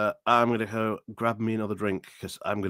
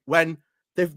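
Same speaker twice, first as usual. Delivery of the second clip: ordinary talk, nothing marked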